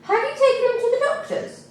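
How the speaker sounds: high, even official question; social worker voice. A narrator's reading. Social worker questioning the parents, high and official. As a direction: raise the pitch into the prim worker. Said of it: "asked the social services worker".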